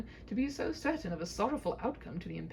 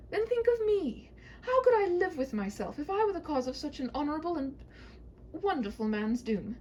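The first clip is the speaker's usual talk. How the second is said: a hint of a pleading princess, higher; lightly voiced worried princess; 8 semitones higher, 3 dB louder than usual. Princess begging the knight, lightly voiced. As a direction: beg him not to fight.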